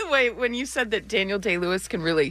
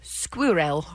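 "Squirrel" said as skwurrel, British; 5 semitones lower than usual. Shown as a demonstration. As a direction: say "Squirrel" the English way. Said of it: "how do the Brits say squirrel".

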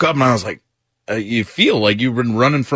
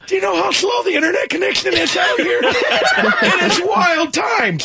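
very high, hammed-up whining boy; Tyler. Stepson griping about farm internet, high mock whine. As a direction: whine it high through laughter. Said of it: "this is exactly how he talks".

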